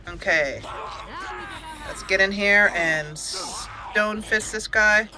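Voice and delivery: ordinary delivery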